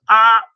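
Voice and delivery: sharp high loud cry; a sudden bird scream from above; eagle screech. Acted. The eagle swooping down on the fish, loud screech.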